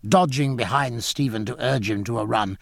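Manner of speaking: ordinary delivery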